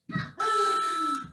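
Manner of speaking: strained laugh through cramp; pained laugh. Real, not acted.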